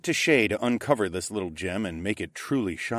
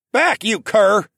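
high, much louder command; 13 semitones higher, 9 dB louder, slower than usual. A narrator's reading. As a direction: drive it back with the broom. Bowden with broom.

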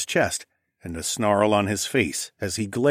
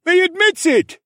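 angry yell; 9 dB louder than usual. Acted. Man in crowd accusing.

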